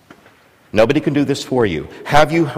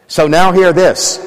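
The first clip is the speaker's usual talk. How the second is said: proclaiming; 6 semitones higher, 4 dB louder than usual. Real, not acted. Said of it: "echoes the proclamation".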